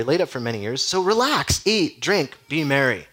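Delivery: hammed-up rich man urging himself to party; gloating rich man. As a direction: tell yourself to relax like a smug rich fool. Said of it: "gloating to himself".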